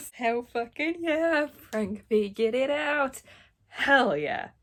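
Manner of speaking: loud, slow triumphant hype; loud hype cheer for Frank. Real, not acted. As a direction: pump your fist and cheer.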